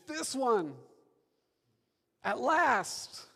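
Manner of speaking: high, loud exclamation in measured phrases; erupting into poetry. Acted. Adam beholding Eve for the first time, exclaimed poetry. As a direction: burst out in awe, phrase by phrase. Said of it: "he erupts into poetry".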